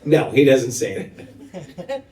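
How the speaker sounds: ordinary delivery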